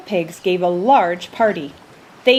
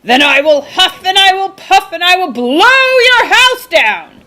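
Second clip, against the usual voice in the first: loud, higher roaring threat; 10 semitones higher, 8 dB louder than usual. A narrator's reading. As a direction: roar the threat at the house, loud. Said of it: "so he roared".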